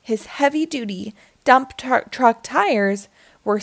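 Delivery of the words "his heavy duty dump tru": usual speaking voice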